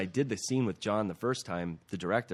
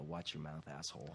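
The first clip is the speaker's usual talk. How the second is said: hushed menacing threat, much softer, as a bully; retold menacing threat; 4 semitones lower, 14 dB quieter than usual. Retelling his audition line to Ralph, softer and menacing. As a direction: lean in close and menace him quietly. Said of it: "And I go".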